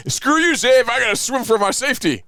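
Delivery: high, loud comic yell; mock panicked shout. Friend abandoning a drowning man, mock panicked shout. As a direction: holler it like a fleeing swimmer.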